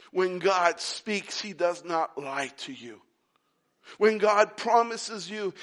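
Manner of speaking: ordinary talk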